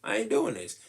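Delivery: defiant refusal. Retold or imitated. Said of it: "is kinda like".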